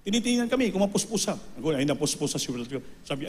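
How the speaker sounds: usual speaking voice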